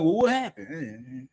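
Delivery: slow, drawn-out puzzled question; mock puzzled boss. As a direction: play the confused boss, stretching it out. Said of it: "Then you're gonna be like".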